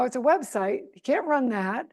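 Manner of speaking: ordinary talk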